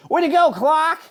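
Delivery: high, loud cheer; cheering friends. A narrator's reading. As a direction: congratulate him. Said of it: "said his friends".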